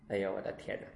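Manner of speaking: low, hushed dismay; dismayed exclamation. Real, not acted. Master reacts to old devotee's excuses, dismayed. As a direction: let it drop, soft and low.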